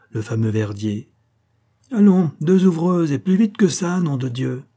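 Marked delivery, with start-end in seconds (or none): 1.9-4.6 high, loud command easing on the oath; shouted order. A narrator's reading. Director summoning two usherettes, shouting. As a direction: bark orders at the staff.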